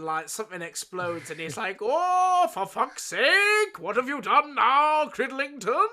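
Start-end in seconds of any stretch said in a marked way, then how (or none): 1.8-5.9 loud, high mock Jamaican exasperation; Jamaican dub. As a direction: draw out the outrage in a Jamaican accent. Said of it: "like, Jamaican dubs of".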